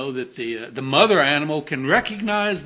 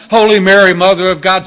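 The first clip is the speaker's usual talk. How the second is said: "Holy Mary" shouted much louder, rising, pleading; desperate praying; 2 semitones higher, 12 dB louder, faster than usual. Retold or imitated. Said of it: "The Catholics would be saying".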